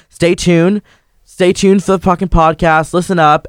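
high, loud call to stay tuned; hyped sign-off. Real, not acted. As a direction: pump the audience up. Host's hyped sign-off to listeners.

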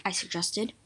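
ordinary delivery

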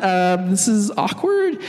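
slow, embarrassed drawl; slow awkward aside; awkward mumble. Retold or imitated. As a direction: drag it out uncomfortably. Woman embarrassed.

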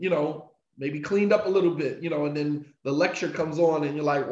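ordinary talk, nothing marked

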